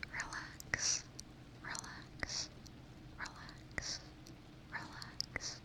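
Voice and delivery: very soft, far-off whispered relax; ASMR trigger words, whispered very softly; close-up trigger words. Pretend. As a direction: breathe each relax right into the mic. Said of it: "Some close-up trigger words?".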